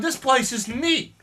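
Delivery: high, slow, dopey admiration; gushing dude voice. Retold or imitated. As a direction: coo it like a starstruck bro. Pizarro gushing to Atahualpa.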